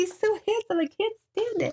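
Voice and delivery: squealing high with delight; gushing. Real, not acted. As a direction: gush over it, high-pitched. Gushing over a smile.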